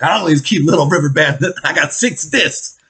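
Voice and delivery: loud, high mock brag; imitated boastful guy. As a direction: crow it like another guy showing off. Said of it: "He's like".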